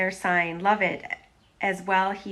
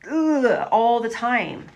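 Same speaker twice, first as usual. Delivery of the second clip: fed-up ugh; 5 semitones higher, 8 dB louder, slower than usual. Retold or imitated. Frustrated sigh about pushing.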